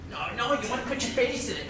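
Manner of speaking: normal talking voice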